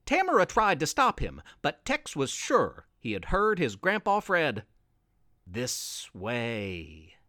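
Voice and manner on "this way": low, soft, eerie beckoning voice; a sinister whisper luring them on; dark voice. A narrator's reading. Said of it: "that same dark voice".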